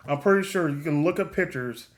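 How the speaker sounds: ordinary delivery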